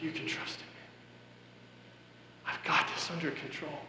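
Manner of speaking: soft hushed whisper, unvoiced; God whispering. God quietly reassuring him in his darkest moment, whispered. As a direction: breathe it like a gentle inner voice.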